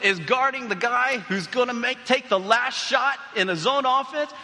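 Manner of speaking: high, loud, excited game call; play-by-play. Retold or imitated. Dad retelling his son's last seconds of the final, excited play-by-play. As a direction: build to a yelled championship call.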